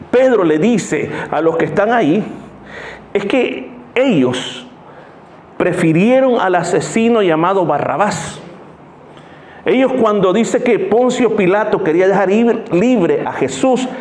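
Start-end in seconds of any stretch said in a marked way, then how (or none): none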